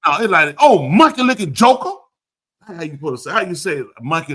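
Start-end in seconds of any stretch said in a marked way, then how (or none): 0.6-1.9 loud, high acted angry insult; mock angry. Host shows her the angry version, loud mock insult. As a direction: hurl it as a hammed-up angry taunt. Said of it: "Say it like angry".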